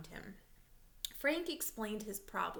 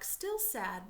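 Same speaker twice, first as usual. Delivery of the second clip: ordinary delivery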